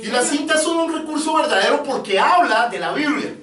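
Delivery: high, slowed, ringing pulpit delivery; emphatic preaching. Real, not acted. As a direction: drive each point home to the congregation.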